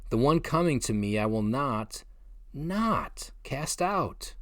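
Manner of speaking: the second "not, not cast out" very slow and weighty; double negative stressed. Shown as a demonstration. Preacher dwelling on the doubled "not", slow and emphatic. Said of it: "The two negatives are piled up".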